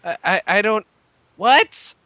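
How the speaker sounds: high confused what; puzzled squeak of what; baffled what. Real, not acted. Confused reply to nonsense.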